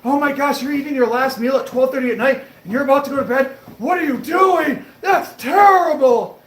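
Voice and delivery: high, loud, slowing outraged scold; hammed-up shocked yell at a late-night eater; imitating an outraged viewer yelling; mock yelling. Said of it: "I had someone yell at me".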